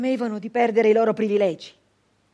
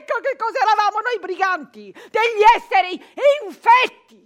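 rising higher and louder to an indignant cry; outraged; 8 semitones higher, 5 dB louder than usual. Acted. Brigand rages at being branded infected, building to a cry.

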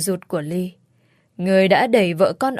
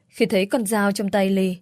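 plain reading voice

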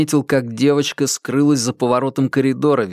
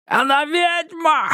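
much higher, slow shrieked accusation; crazed madman; slower than usual. A narrator's reading. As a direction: spit it out like a lunatic.

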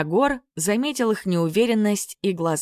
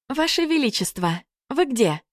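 higher, gentle woman's voice; gentle female voice; 8 semitones higher than usual. A narrator's reading. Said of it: "Раздался нежный голосок".

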